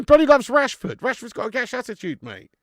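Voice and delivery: loud incredulous rant; exasperated rant. Real, not acted. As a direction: throw your hands up at the manager. Ranting about manager picking Rashford.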